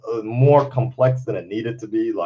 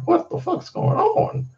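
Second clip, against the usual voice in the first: disbelief. Real, not acted. Disbelief at Warner Brothers.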